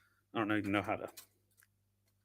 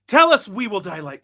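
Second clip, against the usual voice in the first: louder, higher dramatic quote; voiced-out imitation, raised pitch and volume; imitated movie-line inflection; 5 semitones higher, 6 dB louder than usual. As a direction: declaim it like a remembered film line. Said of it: "That's the inflection he uses".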